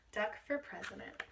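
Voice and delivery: ordinary talk, nothing marked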